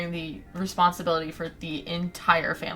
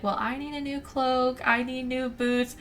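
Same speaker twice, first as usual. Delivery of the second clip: higher-pitched mock entitlement; pitched-up retold whine; imitated demanding sisters; 6 semitones higher than usual. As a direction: lightly mimic a sister putting in her demands. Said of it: "her sister's already like".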